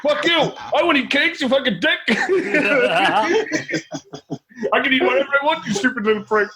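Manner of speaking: angry koala rant. Retold or imitated. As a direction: rant way up high like an enraged little koala. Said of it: "And then he goes".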